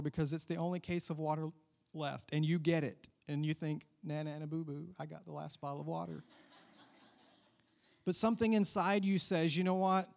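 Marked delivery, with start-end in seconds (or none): 4.0-6.2 soft mock gloating; playful nyah-nyah, said under the voice; childish taunt. Shopper gloating over the last water, quiet mock taunt.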